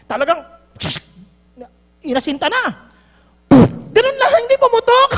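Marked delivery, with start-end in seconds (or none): 3.9-5.1 high, loud burst of disbelief; loud excited telling of the misfire; excited outburst. Retold or imitated. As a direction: blurt it out, amazed.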